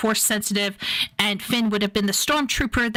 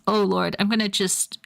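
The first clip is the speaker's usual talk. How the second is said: normal talking voice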